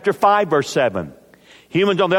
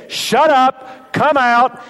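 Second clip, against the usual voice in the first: high, loud command, shut up, come out; forceful command; 9 semitones higher, 3 dB louder, much slower than usual. Acted. Jesus driving the demon out, loud command. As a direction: order the demon out with full authority. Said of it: "He just says".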